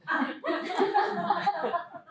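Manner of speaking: bursts into a high laugh. Real, not acted. Laughs at Ray loving Wilder.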